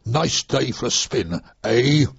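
slow, lightly Canadian drawl on the spin; a hint of a Canadian instructor, slow and dry; broad Canadian accent. Canadian instructor hinting to a slow trainee, light Canadian accent. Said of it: "in a broad Canadian accent".

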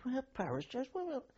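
can't believe what you're hearing; incredulous. Retold or imitated. Tipsy soldier told to wear parachutes, high incredulous echo.

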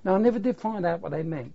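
usual speaking voice

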